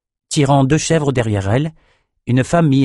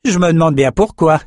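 higher-pitched, mildly scornful grumble; disgusted complaint; 7 semitones higher than usual. A narrator's reading. Thorne wondering why Nathan protects them, disgusted. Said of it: "l'air dégoûté".

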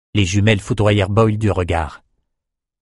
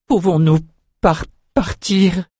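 slow, halting, frightened stammer; stammering in fear; 6 semitones higher than usual. A narrator's reading. Terrified shepherd begs the general to let them go, stammering. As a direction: trip over the words, scared to ask. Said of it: "bredouilla Boyle".

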